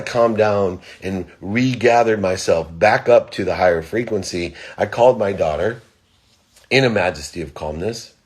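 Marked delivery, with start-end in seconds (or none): none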